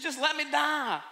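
loud, high, fast complaining rant with a laugh; whiny outburst. Acted. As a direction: vent like a sulking prophet who wants to die. Jonah begs to die.

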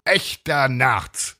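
high, stretched-out proud cry; slow exultant reveal; triumphant call. A narrator's reading. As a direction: proclaim it grandly, drawing it out. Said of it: "rief er".

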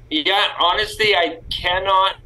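ordinary talk, nothing marked

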